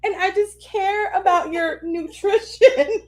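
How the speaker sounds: high, mock-sobbing plea; retold tearful pleading. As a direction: plead through fake tears, laugh creeping in. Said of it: "I'm crying".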